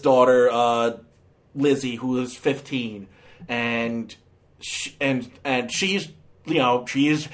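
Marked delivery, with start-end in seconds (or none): none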